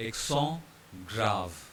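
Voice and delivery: "accent" with silent T, "grave" with sounded V; French accent grave. Shown as a demonstration. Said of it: "we pronounce it as".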